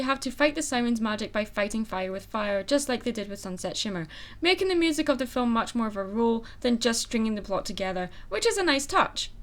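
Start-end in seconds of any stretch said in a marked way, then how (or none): none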